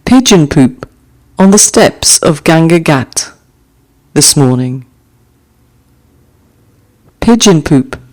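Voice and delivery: gentle recital of a short poem; poem reading. Acted. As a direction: let each image settle before the next. Reads haiku aloud twice.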